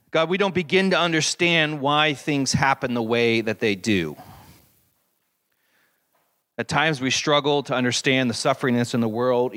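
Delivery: congregational prayer. Acted.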